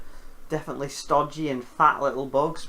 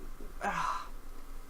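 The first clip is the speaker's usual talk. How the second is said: weary sigh; 4 semitones higher, 11 dB quieter than usual. Real, not acted. Pausing before recommending.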